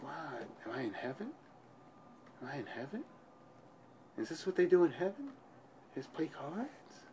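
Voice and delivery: soft, hushed wonder. Retold or imitated. Patient marveling softly, thinking he's in heaven. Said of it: "I'm like".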